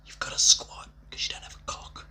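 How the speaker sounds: unvoiced conspiratorial whisper. Real, not acted. Whispering the crude punchline to viewers. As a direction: drop to a whisper for the crude bit. Drops to whisper mid-rant.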